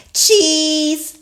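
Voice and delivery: high, loud piglet voice; Peppa. A narrator's reading. Peppa delivers Grampy's shopping, high and loud. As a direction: sing out the item like a child. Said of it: "Peppa said".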